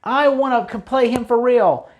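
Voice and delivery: half-hammed angry outcry; mad protest. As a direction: protest to the crowd that it was rigged. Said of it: "that makes him mad".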